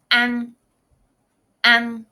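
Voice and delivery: "an," said loud and clear twice; pronunciation demo. Shown as a demonstration. Teacher drilling a word, loud and clear. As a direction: model "an," for the class. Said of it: "and then similar".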